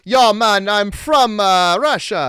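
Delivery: lightly put-on Russian accent, ribbing the actor; bad Russian accent. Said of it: "quality of Russian accent you get out of Zach Ward".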